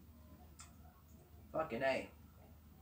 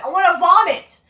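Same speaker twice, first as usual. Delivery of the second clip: higher, much louder disgusted yell; disgusted shout; 10 semitones higher, 12 dB louder than usual. Real, not acted. Furious rant about a wrestler.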